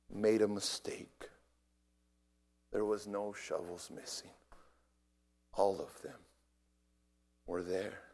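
low, soft, with heavy pauses; hushed storytelling. Real, not acted. Preacher revealing no shovels were missing, hushed. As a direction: let the revelation land quietly, phrase by phrase.

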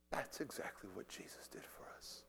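barely audible, low whispered repeat. Real, not acted. Preacher repeating the parallel to Jesus, whispering. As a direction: let the voice fall away to a whisper.

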